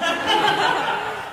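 loud helpless laugh; burst of laughter. Real, not acted. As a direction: laugh out loud, caught off guard. Nervous laugh about secret story.

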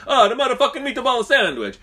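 high, loud cartoon Italian; mock Italian accent. Joke teller redoing the Italian worker's line, mock Italian accent. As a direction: exclaim it in a hammed-up Italian accent. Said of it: "But probably said, like".

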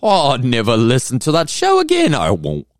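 loud, high, hammed-up British voice; exaggerated mock British protest; bad British accent. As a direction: badly fake an indignant British accent. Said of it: "that probably was not a British accent".

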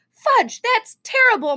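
high-pitched, shocked reproof; scolding mother. A narrator's reading. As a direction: scold a small child in dismay. Mother scolds book thrower.

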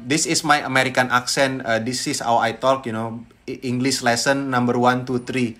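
friend's halting Indonesian-accented American attempt; Indonesian-accented English. Imitating a friend attempting American, Indonesian accent. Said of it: "saat teman gue ngomong kayak".

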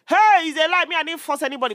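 mock defensive denial.

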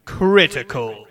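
slow, drawn-out echoing welcome; cave echo. Shown as a demonstration. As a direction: stretch the words into the echo. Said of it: "Do the echo there".

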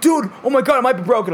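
high, loud shout of alarm; shouted mock panic; panicked friend. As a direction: yell it as the laptop slams down. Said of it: "He's like".

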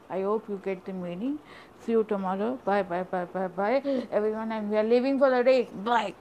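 loud, rushed goodbye to viewers; hurried goodbye. Real, not acted. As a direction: wrap it up fast and loud. Kids rushing to end the video.

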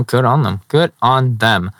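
ordinary talk, nothing marked

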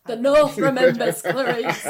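mock Northern accent. Jokey put-on Northern quote.

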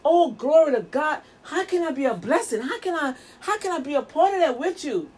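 effusive, swinging pitch, acting eager to bless; hammed-up supportive church member; gushing church praise. Preacher voicing someone asking to be a blessing, gushing. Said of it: "Are you like".

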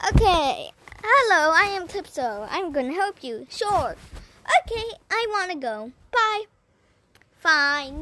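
booming loud character voice. Acted. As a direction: announce it loudly, like a stage play. Calypso and Odysseus acted out.

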